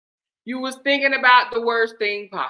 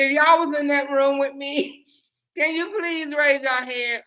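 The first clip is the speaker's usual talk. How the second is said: ordinary talk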